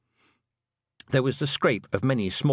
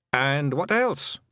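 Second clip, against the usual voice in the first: put-on high, lilting Scots-accented little man; a cheeky tiny clansman owning up; wee Scottish Feegle; 5 semitones higher, much slower than usual. Feegle chief confessing to the young witch, high Scots voice.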